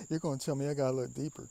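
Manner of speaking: loud. Real, not acted. Loud amused complaint.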